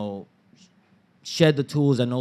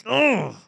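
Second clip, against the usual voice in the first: high, disgusted "ugh"; exasperated groan; 10 semitones higher, 4 dB louder than usual. Retold or imitated. Son bristling at mom's microaggressions, exasperated groan. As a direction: let out a frustrated, high groan.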